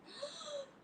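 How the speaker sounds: sharp high gasp; frightened gasp. Acted. Children seeing the cat coming, frightened gasp.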